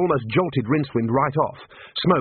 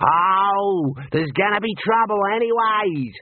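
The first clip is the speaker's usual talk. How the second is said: higher, slow, sneering villain voice; the Emu rider taunting the wizard; bandit's taunt; 8 semitones higher, slower than usual. Acted. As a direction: threaten him with cheerful menace.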